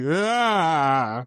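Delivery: high, loud imitated laugh burst; hammed-up impression of his laugh; mock laugh yelp. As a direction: squeal it out to tease him. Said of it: "be like".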